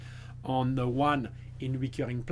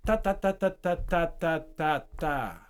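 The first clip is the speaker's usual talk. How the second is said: exponential rhythm; 2 semitones higher than usual. Shown as a demonstration. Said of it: "rhythm that speeds up or so, slow down".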